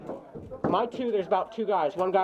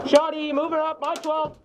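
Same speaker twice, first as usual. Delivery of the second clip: raised urgent warning; shouted callout; 7 semitones higher, slower than usual. Real, not acted. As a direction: call the enemy moving in. Calling enemy to team.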